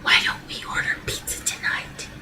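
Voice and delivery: hushed voiceless whisper; soft husband's whisper. Acted. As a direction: breathe it as if not to disturb anyone. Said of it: "whispering again".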